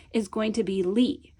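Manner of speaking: ordinary talk, nothing marked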